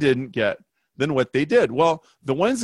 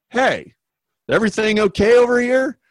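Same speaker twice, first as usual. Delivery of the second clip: higher, fast, calling across the room; a club owner breezing over to check on them; 9 semitones higher, 4 dB louder than usual. Acted. Club owner asks the table if everything is okay, calling across.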